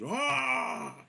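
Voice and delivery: long, high-pitched open yell; acted high war cry; battle yell. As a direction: let out a fighter's drawn-out cry.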